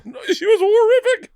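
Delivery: high, loud laugh through the words; laughing hard about the bride. Real, not acted. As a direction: crack up while saying it. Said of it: "Was she a trog?".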